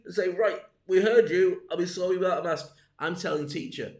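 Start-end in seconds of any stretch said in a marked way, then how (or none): 0.3-2.8 louder and higher, fed-up reply; loud retort. Pretend. Person answering the neighbour, loud retort. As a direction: shout back at the nagging neighbour.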